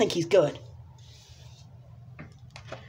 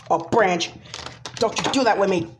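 ordinary talk

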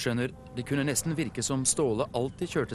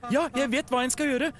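very high, eager little tugboat; high-pitched impatient call; eager shout; 12 semitones higher, 3 dB louder than usual. A narrator's reading. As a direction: blurt it out before the boss has finished. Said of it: "ropte Theodor nesten før trafikksjefen".

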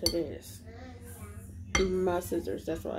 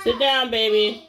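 louder, slow, firm instruction; 4 semitones higher, 7 dB louder than usual. Real, not acted. Mother telling her baby from across the room to sit down, loud and slow. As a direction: tell the little one to sit, calm but firm.

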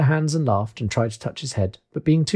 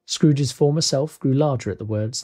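ordinary delivery, nothing marked

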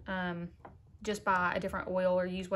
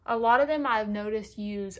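usual speaking voice

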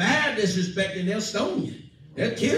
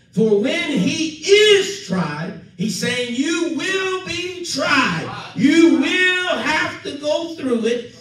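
full-force preaching; 6 semitones higher, much slower than usual. Acted. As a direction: drive it home to the congregation.

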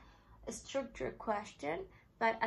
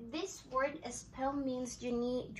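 ordinary talk

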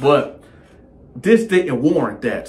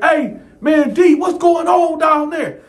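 shouting; 14 semitones higher, 4 dB louder than usual. Retold or imitated. Neighbor yelling down the tier.

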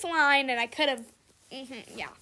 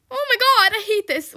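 higher, louder oh my God; exasperated outburst; 6 semitones higher, 7 dB louder than usual. Real, not acted. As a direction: burst out in frustration. Frustrated while stuck in the lane.

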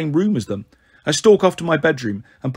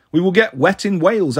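ordinary delivery, nothing marked

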